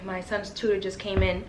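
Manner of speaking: ordinary talk, nothing marked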